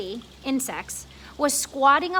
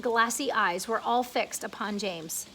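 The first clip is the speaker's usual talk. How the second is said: ordinary delivery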